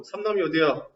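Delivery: ordinary talk, nothing marked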